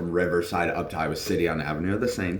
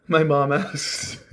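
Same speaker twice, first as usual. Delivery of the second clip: cracking up mid-sentence; laughing; 9 semitones higher, slower than usual. Real, not acted. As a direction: laugh through the words. Laughing at the story.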